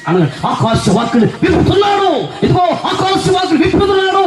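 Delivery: high, shouted proclamation; mock prophetic shouting; hyped blessing preacher. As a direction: mimic an ecstatic pulpit announcement, climbing in pitch.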